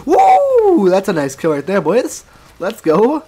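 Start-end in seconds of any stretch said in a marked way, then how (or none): 0.1-0.9 excited whoop. Real, not acted. Celebrating a kill.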